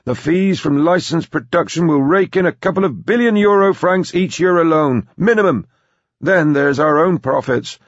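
put-on high, loud old tycoon on a roll; gleeful. Philip Evans counting the company's coming profits, gleeful. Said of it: "said gleefully".

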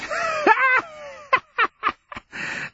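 very high crazed cackle; long high-pitched mad laugh; mad old cackle. A narrator's reading. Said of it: "cackling reproach".

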